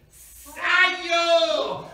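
long, high scream of pain; tremendous scream. Acted. Sorcerer burned by red-hot iron, tremendous scream. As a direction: let out a huge high howl. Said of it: "Un grito tremendo del brujo".

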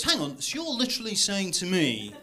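incredulous English pal, imitated; mock English accent. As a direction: repeat it back in a mock-English voice. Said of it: "And he goes".

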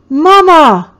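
loud, high scream. A narrator's reading. Toddler screaming for her mama, loud and high. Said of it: "Eatha screamed".

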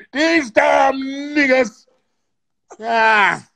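slow, high dramatic shout; overacted feeling. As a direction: ham up the fury, stretching every word. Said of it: "I need more feeling".

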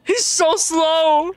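high, loud wailing complaint; exasperated amusement. Real, not acted. Player watching a stuck game character, exasperated wail. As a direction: wail in disbelief, stretching the last words.

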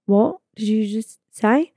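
slow, deliberate disbelief; retold stunned question, spaced out. Passenger hearing about the hotel night, stunned.

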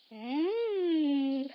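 ordinary talk

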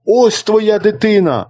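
a brother urging his silent sister to speak; calling out. A narrator's reading. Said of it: "домчав і вигукнув". (брат вигукує до сестри, вище й наполегливо)

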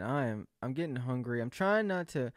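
ordinary talk, nothing marked

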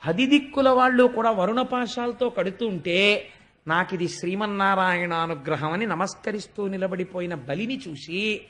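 ordinary delivery

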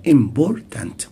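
"امبورتانت" punched on BOR, the middle syllable; im-BOR-tant, hit the middle of "امبورتانت"; stress on second syllable. Shown as a demonstration. Teacher helping with pronunciation, second syllable stressed. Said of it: "أو حتى في التاني".

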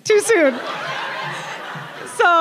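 too soon said high through a laugh; mock-offended, laughing. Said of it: "in my mind".

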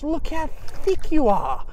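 high, slow, delighted cooing at the shell; thrilled cooing. Real, not acted. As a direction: coo over it like a treasure. Talking fondly to a shell.

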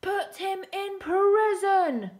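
high, drawn-out shouted command; emperor's shout. Acted. Emperor sending Su Ming to prison, shouting. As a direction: bellow the order slowly in a storybook tyrant's voice. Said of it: "shouted the emperor".

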